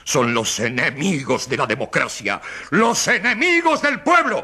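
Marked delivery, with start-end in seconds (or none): 2.7-4.4 rising oratory. Acted. Orator to the jury, climbing to a high peak. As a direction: voice climbs high on the final words. Speech climax, pitch jumps.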